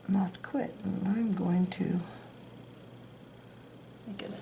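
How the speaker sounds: unvoiced whisper, slow. Real, not acted. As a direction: whisper it to yourself, half-hidden. Muttering to herself while drawing.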